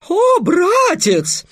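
very high, slow, loud cry; falsetto exclamation. Acted. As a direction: squeal it like a stage heroine. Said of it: "воскликнул Песя". (восклицание фальцетом)

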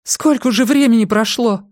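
high-pitched panicky question; panicked. A narrator's reading. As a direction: demand the time in rising alarm. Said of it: "Паника всерьёз ими овладела".

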